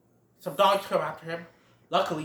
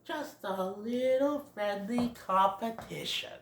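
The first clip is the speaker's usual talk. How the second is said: slow, softer smug put-on voice; smug bully; 4 semitones higher, 5 dB quieter, slower than usual. Ronald brushing off the fight, smug. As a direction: play it sly and innocent. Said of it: "Ronald's all like".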